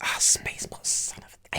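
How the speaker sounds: real whispered self-reproach; whispered frustration. Real, not acted. Cohost learns the answer he missed, whispered frustration. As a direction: whisper it to yourself, annoyed.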